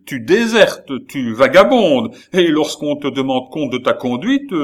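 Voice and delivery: loud, high, scolding rant; angry tirade. Acted. Baron scolding the runaway Gilbert, loud angry rant. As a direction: tear into him without pause. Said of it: "s'écria-t-il".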